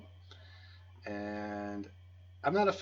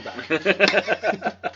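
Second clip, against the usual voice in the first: announcer voice; 7 semitones higher, 11 dB louder than usual. Acted. Podcast host after a break.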